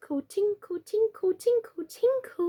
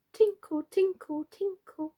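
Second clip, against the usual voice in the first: higher chimes growing softer; music box tinkle, fading; 7 semitones higher, 4 dB quieter than usual. A narrator's reading. Music box playing on after the fire, fading chime. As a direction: let the tinkles fade away. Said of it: "said the musical box".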